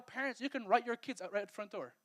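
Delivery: ordinary talk, nothing marked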